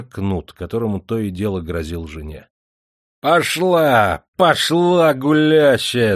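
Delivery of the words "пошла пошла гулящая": high, loud shouting, slowing at the end; drunk angry shout. Acted. Drunk husband yells at his wife, high and loud. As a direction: yell at her like a drunken bully. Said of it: "закричал он вдруг на жену".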